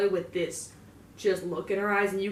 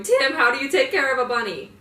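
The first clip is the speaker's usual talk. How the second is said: louder, higher, hammed-up tearful wail; mock crying plea; 5 semitones higher, 10 dB louder than usual. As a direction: overdo the sob in the question. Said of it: "Voice cracked and all".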